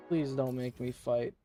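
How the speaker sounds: normal talking voice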